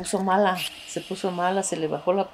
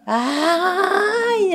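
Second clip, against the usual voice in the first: sharp high mock scream; retold high ugly wail; 11 semitones higher, 4 dB louder than usual. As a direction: imitate a horrible cry. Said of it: "así muy feo".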